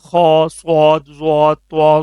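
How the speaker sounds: خا صواد ضوا طوا said full and heavy; heavy letters. Shown as a demonstration. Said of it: "اس کو موٹا کر کے پڑھنا ہے".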